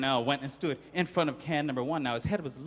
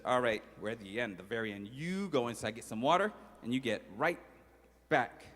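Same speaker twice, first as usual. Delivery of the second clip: softer dismissive order; quiet bossy sister; 5 semitones lower, 6 dB quieter than usual. Acted. Little sister quietly sending her brother for water. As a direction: send him off quietly.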